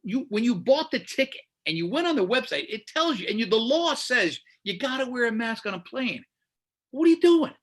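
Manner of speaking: exasperated rant. Real, not acted. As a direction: rant at the dummies. Ranting about maskless passengers.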